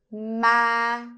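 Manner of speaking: ma held level, a bit high; flat tone on ma; Vietnamese level tone. Shown as a demonstration. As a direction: keep ma steady and high. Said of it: "You keep it stable, a little bit high".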